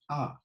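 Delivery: "A" said as open AH; old vowel sound. Shown as a demonstration. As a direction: sound the letter "A" as "ah". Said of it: "used to be pronounced A".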